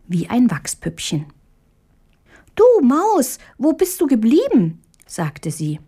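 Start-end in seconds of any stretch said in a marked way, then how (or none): 2.5-4.8 put-on high-pitched tiny girl calling out; small, high, questioning voice; little girl Marie. A narrator's reading. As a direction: call the mouse like a puzzled child.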